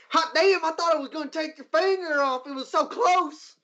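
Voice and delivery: high, amazed patron voice; excited bar patron. Acted. Patron marvelling at the near miss, high amazed voice. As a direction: marvel like a rowdy local. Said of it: "that guy is like".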